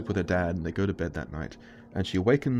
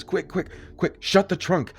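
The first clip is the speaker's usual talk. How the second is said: much higher, loud urgent yell; 10 semitones higher, 4 dB louder than usual. Retold or imitated. John pinning Melissa, yelling at Sophia to shut it. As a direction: hurry her with a yell. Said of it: "so he yells to Sophia".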